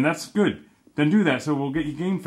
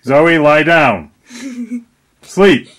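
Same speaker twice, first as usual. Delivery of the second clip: slow, loud mock order; mock yell; 9 dB louder, much slower than usual. Shown as a demonstration. Dad directing the video, pretending to yell at Zoe, loud and slow. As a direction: bark the order in play, slowly. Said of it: "I'll yell at you".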